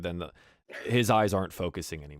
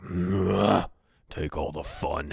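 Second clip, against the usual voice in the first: lightly voiced sulky orc; groan, then grumble off; mildly put-out orc grumble; grumbling orc; 6 dB louder, slower than usual. Orc grumbles at being sent out.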